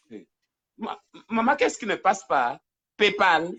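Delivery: ordinary talk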